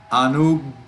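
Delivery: anugaham stopped on the g, then released after a pause; pause on double consonant. Shown as a demonstration. Teacher showing a double consonant, a held stop at the g in anugaham. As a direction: get stuck on the g in anugaham, then go on. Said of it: "We pause for a little moment".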